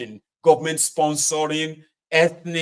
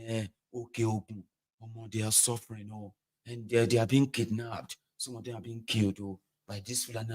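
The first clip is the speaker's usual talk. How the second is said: sober concern; 4 semitones lower, 6 dB quieter than usual. Acted. Acting Yoruba group's sober discussion.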